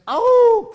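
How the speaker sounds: very loud, high held "owww"; sudden playful howl, far above speaking pitch; mock howl. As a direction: howl out one long high note at the wrong word. Alarm at the word betrayed.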